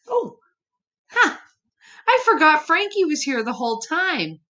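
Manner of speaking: a startled high cry, then a laugh at herself; sudden surprise. Real, not acted. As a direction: caught off guard, yelping up high. Suddenly notices the dog Frankie.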